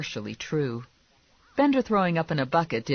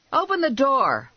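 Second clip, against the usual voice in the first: high, loud demand at a door; shouting; 12 semitones higher, 6 dB louder than usual. Acted. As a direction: shout to be let in. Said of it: "I shouted".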